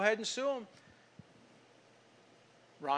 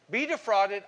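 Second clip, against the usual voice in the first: louder, higher punch on "Be defrauded"; "Be defrauded" driven home from the pulpit; preaching emphasis; 4 semitones higher, 6 dB louder than usual. Real, not acted. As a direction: preach "Be defrauded" hard and high. Preacher hammers the lesson.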